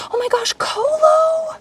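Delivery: high, slow delighted squeal; light up over a surprise raid; real thrilled gasp of a name; excited squeal. Real, not acted. Thanked for an incoming raid.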